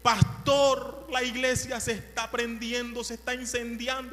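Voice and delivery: high, loud alarm on the phone; panicked caller. Retold or imitated. Neighbor phoning the pastor that the church burns, panicked and loud. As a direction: cry out in panic, church on fire.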